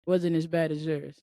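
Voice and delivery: normal talking voice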